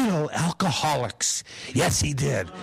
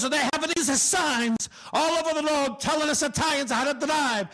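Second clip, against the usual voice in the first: Italian uncle; 5 semitones higher than usual. Retold or imitated. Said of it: "He goes".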